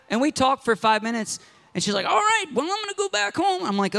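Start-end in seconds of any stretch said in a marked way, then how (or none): 2.1-3.6 put-on high old lady's farewell; lightly voiced old lady. Old lady heading home, high-pitched. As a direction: chirp it like a grandma.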